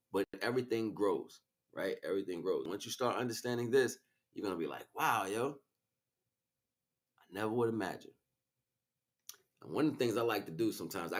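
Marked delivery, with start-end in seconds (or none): none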